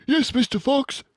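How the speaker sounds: keen to obey; eager young badger. A narrator's reading.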